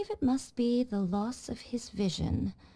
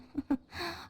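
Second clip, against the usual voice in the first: short, knowing laugh that rises; amused scoffing laugh; 7 semitones higher, 5 dB quieter than usual. Acted. Shrine maiden mocking the ideal of stillness, amused laugh. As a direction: laugh off the idea as naive.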